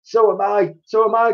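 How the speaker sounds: high, loud frightened cry. A narrator's reading. Mister Twit answering his wife, high frightened cry. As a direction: cry out in agreement. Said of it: "cried Mister Twit".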